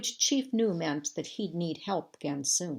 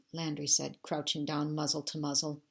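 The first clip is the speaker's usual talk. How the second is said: ordinary delivery, nothing marked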